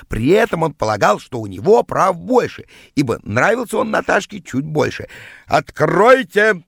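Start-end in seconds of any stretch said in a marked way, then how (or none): 5.5-6.6 loud, drawn-out yell, higher. A narrator's reading. Rival yells at the door to be let in, yelling. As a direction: bellow it through a shut door. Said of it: "стал орать он под дверью".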